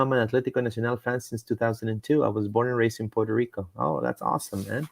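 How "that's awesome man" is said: ordinary talk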